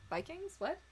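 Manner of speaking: baffled echo, pitch leaping up on "Vikings?"; surprised question. Real, not acted.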